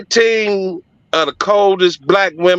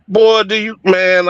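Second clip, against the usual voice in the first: normal talking voice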